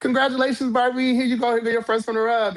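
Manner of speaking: high, bright hostess voice, fast at the end; cheery congratulations. Retold or imitated. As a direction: sing it out sweetly, like handing a prize. Cheerfully handing over the envelope.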